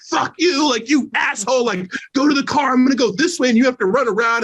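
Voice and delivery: imitating angry girlfriend. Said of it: "And she was like".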